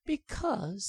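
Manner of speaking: high pleading bird voice; tiny high bird begging for its life. Acted. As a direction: squeak like a begging little bird.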